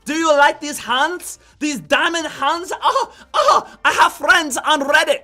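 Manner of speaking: hammed-up Borat-like accent, high, loud and slow; loud, over-the-top invented accent with mock wails; made-up foreign accent. Trader mocking hopeful holders with diamond hands, in a loud made-up foreign accent. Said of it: "is that a Borat accent?".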